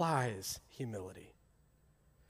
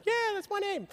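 son's voice. Retold or imitated. Preacher voices his waving son.

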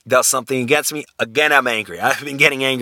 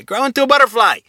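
much higher, louder urging call; emphatic encouragement; 12 semitones higher, 4 dB louder than usual. Shown as a demonstration. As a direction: cheer the listener on to grow. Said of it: "But say a butterfly".

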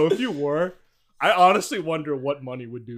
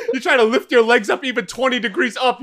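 usual speaking voice

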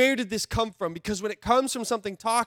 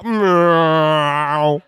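louder silly cat meow; loud playful meow; mock meow; 5 semitones lower, 9 dB louder than usual. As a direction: meow like a cartoon kitten.

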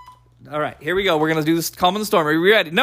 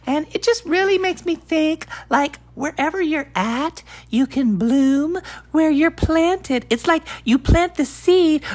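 hamming up a sappy podcast host; mock inspirational; 7 semitones higher than usual.

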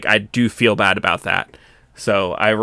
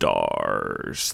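lower, softer, drawn-out monster growl; Nemesis zombie voice; 9 dB quieter than usual. Retold or imitated. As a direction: rumble it like a stalking zombie. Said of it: "he had a little deep zombie voice".

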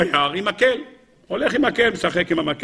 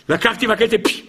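ordinary talk

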